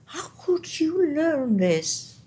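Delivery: high, drawn-out incredulous question; retold amazement. Conductor to singer about her Russian, retold amazement.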